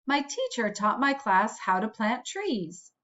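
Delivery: plain reading voice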